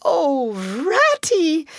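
high wail of dismay; high, distraught appeal; despairing cry. A narrator's reading. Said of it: "he cried in despair".

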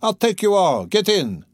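high, rousing invitation; shouted. A narrator's reading. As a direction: holler it out to everyone around. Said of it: "shouted one of them".

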